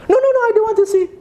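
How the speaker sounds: very high, fast panicky refusal; frightened child. Acted. Child refuses to look out the window, panicky. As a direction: squeal it quickly like a scared kid.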